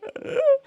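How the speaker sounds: a squeaky burst of laughter, jumping way up in pitch; sudden high laugh. Real, not acted. Streamer caught off guard by a chat joke, high squeaky laugh. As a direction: let out a surprised, high-pitched giggle.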